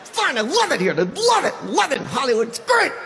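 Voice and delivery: over-keen gushing, ending in a high squeak; forced cheerfulness. Acted. Claiming to love Hollywood, forced cheer. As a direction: overdo the happiness until the voice jumps high. Overdoing happiness about Hollywood.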